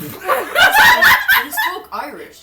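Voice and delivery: slow, high, loud laugh-talk; laughing teasing. Real, not acted. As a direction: stretch it out laughing.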